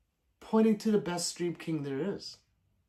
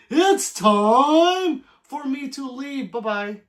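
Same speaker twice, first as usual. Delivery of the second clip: high, loud, slow laughing put-on voice; mock departing voice; 9 semitones higher, 10 dB louder, much slower than usual. Mocking Josh's exit in a goofy voice. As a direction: drag it out in a goofy voice. Mock voicing Josh leaving.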